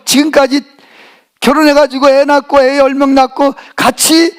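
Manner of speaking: usual speaking voice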